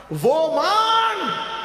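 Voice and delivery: high, slow drawn-out shout; preaching shout. Real, not acted. Preacher urging listeners what to ask Allah, in a long high shout. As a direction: call it out to the whole hall.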